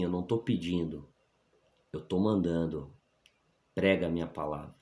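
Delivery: lightly voiced command, measured and firm; a hint of calm divine authority; God. God orders the reluctant man to preach, lightly voiced firm command. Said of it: "uma autoridade tão grande".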